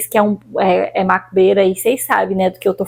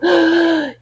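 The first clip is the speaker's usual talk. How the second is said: gasp; 6 semitones higher than usual. Retold or imitated. Retelling gasping for air.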